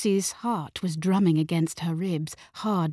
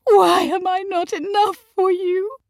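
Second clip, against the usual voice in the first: high, loud, pleading wail; keening; 13 semitones higher, 6 dB louder than usual. A narrator's reading. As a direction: cry it out in wounded despair. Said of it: "Mamma keened".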